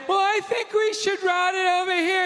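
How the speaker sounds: mock the plumber's butting-in wife; meddling wife. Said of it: "his wife, who isn't a plumber, comes walking in".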